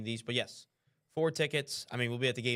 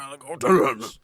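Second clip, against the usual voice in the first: slow, loud, thick Cajun Ed Orgeron growl of go Tigers; Cajun-accented slow cheer in the Ed Orgeron voice; Ed Orgeron impression; 9 dB louder, slower than usual. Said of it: "All I gotta do is talk like this".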